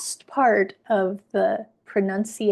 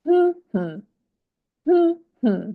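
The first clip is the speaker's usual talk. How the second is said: hummed strong-weak rhythm demonstration; first-syllable stress hum; 7 semitones higher, faster than usual. Shown as a demonstration. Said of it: "stressed on the first syllable".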